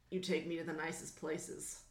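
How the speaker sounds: low, soft, gruff character voice. Acted. Cowboy star flattering his date, low gruff voice. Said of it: "Can I go gruff and make it make sense".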